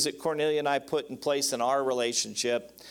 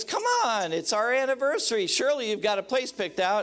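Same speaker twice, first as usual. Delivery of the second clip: a wife pressing her husband on their anniversary, high and insistent; wife insisting; 6 semitones higher than usual. Retold or imitated. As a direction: press him like an expectant wife. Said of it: "and she goes".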